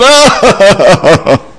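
sudden high loud oh; stunned cry at bad news; shocked cry. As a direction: jolt of alarm. Cat owner hears the shock.

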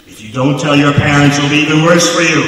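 very loud stern threat; mock cop warning. Railroad cop threatening the boys, loud mock warning. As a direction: warn them hard like a cop.

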